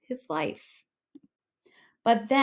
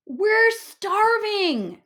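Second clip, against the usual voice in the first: starving friends; 12 semitones higher, 6 dB louder, much slower than usual. A narrator's reading. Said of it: "said his friends".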